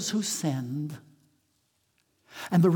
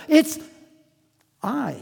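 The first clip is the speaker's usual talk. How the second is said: ordinary talk, nothing marked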